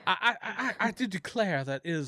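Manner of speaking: lightly hammed-up Southern accent. Game player slipping into Southern talk, mock Southern accent. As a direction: stammer into a mock Southern exclamation. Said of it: "trying to talk like Southern".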